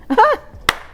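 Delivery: high, loud burst of laughter; bright laugh. Real, not acted. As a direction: laugh out at the memory. Laughing while retelling to husband.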